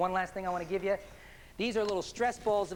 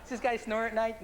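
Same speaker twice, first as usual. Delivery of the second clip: ordinary talk, nothing marked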